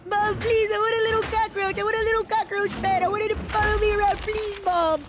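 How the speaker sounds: put-on high pleading child voice; whiny kid. Gamer voicing a kid begging Mom for a pet, high whine. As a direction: beg Mom like a spoiled little kid. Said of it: "somebody throwing a tantrum".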